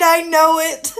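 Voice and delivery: laughing it out loud. Real, not acted. Laughing to the streamer at the chat's joke. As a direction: cracks up while saying it. Chat says they're smoking weed.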